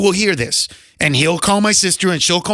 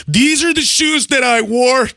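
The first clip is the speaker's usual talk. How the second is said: higher, slow hawker's call; mock sales pitch; 5 semitones higher than usual. Broke comic selling his old shoes, mock pitch. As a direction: peddle your old belongings, stretching the words.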